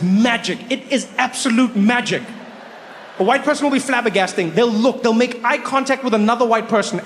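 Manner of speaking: ordinary delivery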